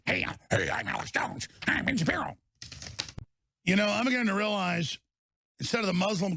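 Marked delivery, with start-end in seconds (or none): 0.1-2.4 Alex Jones imitation. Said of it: "Let me do an Alex Jones imitation".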